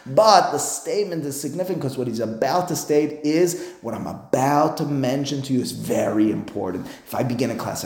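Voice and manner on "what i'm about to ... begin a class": ordinary talk, nothing marked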